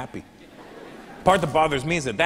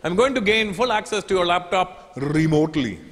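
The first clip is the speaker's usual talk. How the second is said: put-on gloating Indian accent; 6 semitones higher, 3 dB louder than usual. Agent taunts the comedian for his jokes in a gloating Indian accent. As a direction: relish the revenge in an Indian accent.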